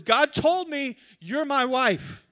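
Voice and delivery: high, loud, slow proclamation; mock earnest declaration. Guy claiming God's word to a girl, mock-solemn declaration. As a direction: declare it with overblown certainty. Man's proposal claim acted out.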